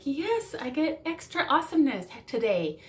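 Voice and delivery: high, cheering triumph; excited. Pretend. Happy partner in the parking lot, cheering. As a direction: whoop it out with joy. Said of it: "you're like".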